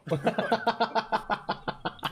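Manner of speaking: loud high burst of laughter; cracking up hard; laughing. Real, not acted. Laughing at own joke.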